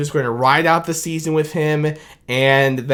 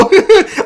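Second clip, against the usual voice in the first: giddy high laugh; 6 dB louder than usual. Real, not acted.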